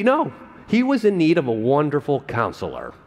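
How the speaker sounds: usual speaking voice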